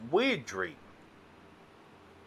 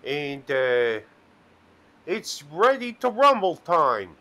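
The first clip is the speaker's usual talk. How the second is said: louder, higher wrestler boast; hammed-up John Cena; 4 semitones higher, 5 dB louder than usual. Retelling a dream, mock wrestler boast, louder and higher. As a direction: puff up like a wrestler announcing himself. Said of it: "he says".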